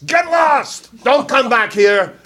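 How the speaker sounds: high, loud alien warning; mock yell. Aliens shouting at the astronauts, high and loud. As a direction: yell them off the moon. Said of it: "in no uncertain terms".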